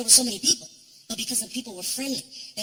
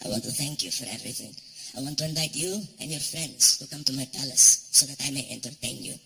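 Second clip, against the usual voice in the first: formal prince; 10 semitones lower than usual. Retold or imitated. Prince inviting the comic to his palace, low. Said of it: "he says".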